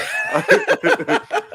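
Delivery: big burst of laughter; loud laugh. Real, not acted. Laughs after being told off.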